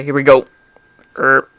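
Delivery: ordinary delivery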